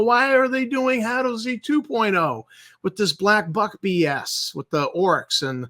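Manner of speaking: normal talking voice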